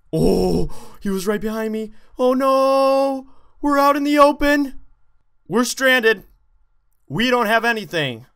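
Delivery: real panicked yell, high and loud; panicked high cry. Real, not acted. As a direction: cry out in alarm as the danger lands. Panicked shout in game.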